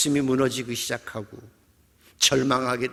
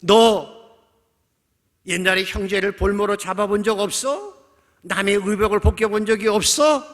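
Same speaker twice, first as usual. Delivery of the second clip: loud, high-pitched grilling; accusing interrogation; 7 semitones higher, 8 dB louder than usual. Acted. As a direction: fire off accusing questions. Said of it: "없는 죄도 만들어내요". (큰 소리로 추궁하듯)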